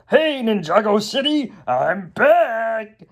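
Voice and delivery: high, hammed-up villain announcement; showy villain call-out; Garmadon. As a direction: announce your return to the whole city. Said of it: "And then he's like".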